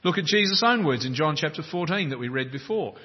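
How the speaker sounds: ordinary delivery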